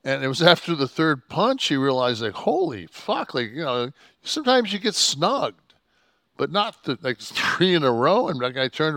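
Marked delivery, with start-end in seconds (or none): 2.3-3.3 high, slow, stunned holy fuck; shocked realization. Retold or imitated. Wrestler recalls the moment he realized the punches were real, stunned. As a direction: let it dawn on you, aghast. Realizing he got hit three times.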